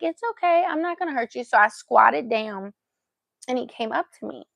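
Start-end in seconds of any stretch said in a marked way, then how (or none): 0.0-1.4 high-pitched soothing voice; gentle coaxing. Retold or imitated. Retelling how she squatted to the stray, gentle coaxing. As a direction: reassure a hesitant animal. Retold coaxing of hesitant dog.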